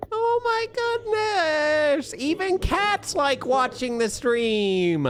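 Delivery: slow, very high squeal; squealing excited voice. Pretend. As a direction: gush like a thrilled fan. Reading donation in voice.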